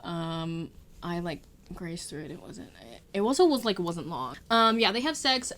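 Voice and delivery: normal talking voice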